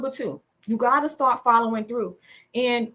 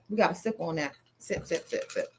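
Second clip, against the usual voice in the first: quiet teasing; 6 semitones lower, 9 dB quieter than usual. Acted. Sipping tea joke, soft.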